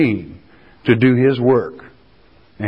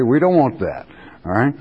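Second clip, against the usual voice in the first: usual speaking voice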